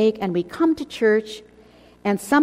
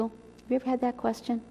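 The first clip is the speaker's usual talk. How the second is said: usual speaking voice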